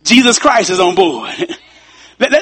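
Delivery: ordinary talk, nothing marked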